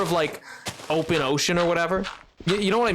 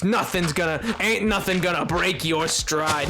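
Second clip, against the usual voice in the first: ordinary delivery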